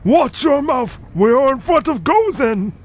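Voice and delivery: high sharp reprimand; scolding character voice. Acted.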